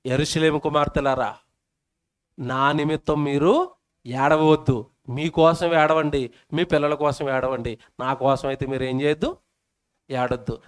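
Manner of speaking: a lightly voiced tender Jesus; Jesus speaking gently. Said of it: "యేసుప్రభు అన్నాడు".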